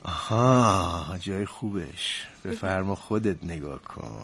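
low, soft and drawn out; savoring the reveal. Acted. Husband showing wife his profit, savoring it. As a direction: stretch it slowly, relishing the moment.

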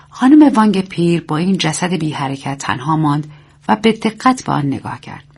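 ordinary delivery, nothing marked

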